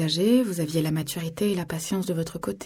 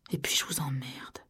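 soft, tired brush-off; weary; 3 semitones lower, 7 dB quieter than usual. A narrator's reading. Narrator giving up arguing with Jones, weary and soft. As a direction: let it out wearily, voice dropped. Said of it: "dis-je d'une voix lasse".

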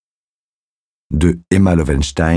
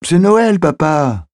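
put-on excited little girl; 13 semitones higher, slower than usual. A narrator's reading. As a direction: protest gleefully like a small kid. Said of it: "s'exclama la petite fille".

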